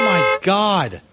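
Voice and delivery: ordinary delivery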